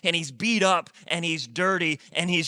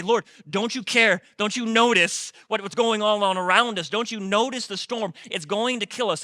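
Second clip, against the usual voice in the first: panicked plea; 7 semitones higher, 6 dB louder than usual. Retold or imitated. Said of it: "in their panic and in their terror, and they say".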